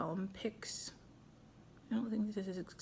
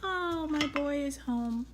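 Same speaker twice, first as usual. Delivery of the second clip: higher, slow, drawn-out whine; whining happy dog; 5 semitones higher than usual. Retold or imitated. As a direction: voice an excited dog whining for its boy. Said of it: "he starts whining and saying".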